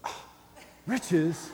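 mock feminine scoff at "riches"; huff, then toss off the word with disdain; hammed-up dismissive gasp; women's disdain. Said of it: "Some of the women can go".

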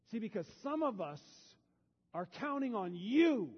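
loud, high, fervent preaching climax; preaching at full force. Acted. Pastor rallying the congregation with a loud preaching cry. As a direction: shout it out like a coach rallying the team.

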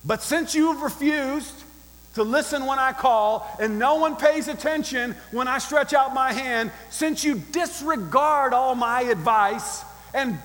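forceful, ringing indictment; stern rebuke. Acted. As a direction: build the accusation clause by clause. Reads Wisdom's angry rebuke at full force.

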